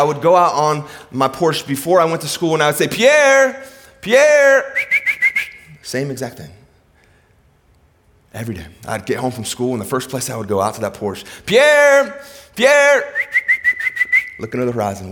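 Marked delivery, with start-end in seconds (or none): 2.9-4.7 high, loud call across the valley. Retold or imitated. Boy calling his lost dog from the porch, loud and high. 11.4-13.1 high, loud call across the valley. Retold or imitated. Boy calling his lost dog from the porch, loud and high.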